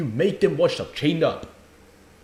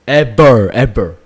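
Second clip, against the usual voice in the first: "Ever, ever" bellowed, much louder; angry shout; 3 semitones lower, 13 dB louder, slower than usual. Real, not acted. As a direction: blast "Ever" out in real fury. Streamer furious at parents' letter.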